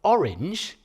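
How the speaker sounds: Orange with stress on the first syllable, O-range, rising; trochee stress. Shown as a demonstration. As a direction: show Orange as strong then weak. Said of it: "is a trochee".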